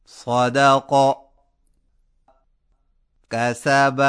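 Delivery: loud, slow, clearly articulated practice words; teacher leading a loud recitation drill. Shown as a demonstration. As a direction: recite each word loudly for the class to repeat. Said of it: "آئیے مشق کیجیے".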